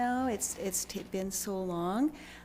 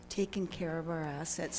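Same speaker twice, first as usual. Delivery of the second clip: ordinary talk, nothing marked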